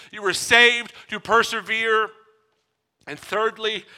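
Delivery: normal talking voice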